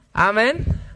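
loud amen with rising, questioning end; prompting amen. Real, not acted. Preacher asking the congregation to agree, loud rising prompt.

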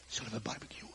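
soft, low, casual offer; low-key invitation. Acted. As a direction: suggest it quietly, as a surprise. Jesus casually invites, first take.

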